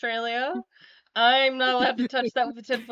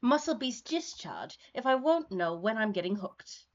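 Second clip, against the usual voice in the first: lightly voiced character, measured delivery; in-character script reading; 2 semitones lower, 7 dB quieter than usual. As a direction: read the character's complaint with deliberate phrasing. Reads a character's lines in the script.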